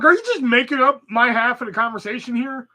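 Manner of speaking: exasperated. Retold or imitated. Acted exasperation at confusing customer.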